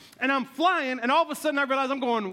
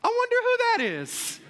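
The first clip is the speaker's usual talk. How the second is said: much higher, puzzled onlooker voice; high, innocent wondering; mock wondering wife; 11 semitones higher than usual. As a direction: play the clueless wife watching. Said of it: "And they're like".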